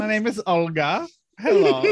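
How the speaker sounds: put-on playful, uncertain German accent; badly faked German character voice; mock German accent. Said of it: "Is that German?".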